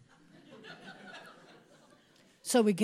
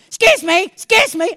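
loud, brisk excuse me; pushy foreigner; 7 semitones higher, 12 dB louder than usual. Retold or imitated. Pushing through the crowd, loud brisk excuse me. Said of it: "I'm gonna become a foreigner".

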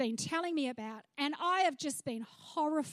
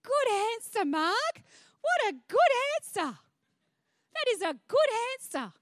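delighted praise; 5 semitones higher, 5 dB louder than usual. Retold or imitated. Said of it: "I said".

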